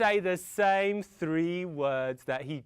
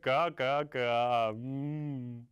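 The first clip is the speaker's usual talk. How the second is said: self-satisfied crow; 8 semitones lower, much slower than usual. Acted. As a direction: caw smugly, congratulating yourself. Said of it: "patted himself on the shoulders and looked around and said".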